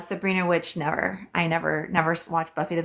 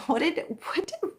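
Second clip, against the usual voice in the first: ordinary talk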